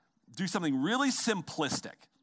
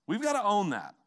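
We've gotta own that, pushed up louder and higher; louder, higher repeat; 6 semitones higher, 4 dB louder than usual. Shown as a demonstration. Pastor presses the congregation harder, louder and higher. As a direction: hammer it home the second time. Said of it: "And we've gotta own that".

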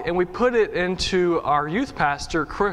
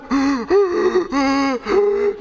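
imitated winded wheezing gasps; breathless gasping; 8 semitones higher, 4 dB louder than usual. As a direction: act out fighting for air. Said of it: "I didn't, couldn't breathe for minutes".

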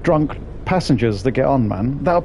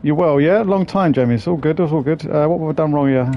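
usual speaking voice

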